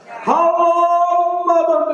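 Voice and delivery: high sing-song giant greeting. A narrator's reading. The giant greets his mother, high and sing-song. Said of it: "the giant said".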